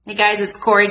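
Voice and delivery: ordinary talk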